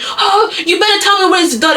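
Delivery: high-pitched mock panic; acted desperate demand. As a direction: play a frantic father making demands, pitch up.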